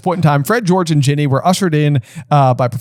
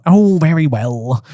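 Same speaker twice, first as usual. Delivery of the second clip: Phineas voice; slower than usual. Acted. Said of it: "said the wizard called Phineas".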